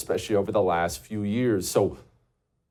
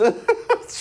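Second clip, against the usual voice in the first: high-pitched burst of laughter; 3 dB louder than usual. Real, not acted. Host cracking up after mocking the accent, high laugh.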